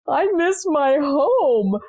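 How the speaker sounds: a high, slow child's whimper, lightly voiced; a small boy missing home, drawn out high; little son crying. Son to mother in the car, a slow high whimper.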